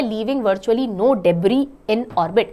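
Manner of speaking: ordinary delivery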